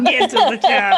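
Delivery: a quick Schwarzenegger echo; Arnold impression. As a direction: toss back the action-hero line. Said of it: "Arnie's just gonna be like".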